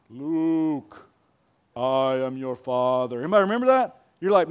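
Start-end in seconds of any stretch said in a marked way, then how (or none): 0.1-3.2 low, slow Vader voice; Darth Vader. Retold or imitated. Preacher quoting Vader's famous line, low and slow. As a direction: drop the voice deep and slow for Vader. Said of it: "He says".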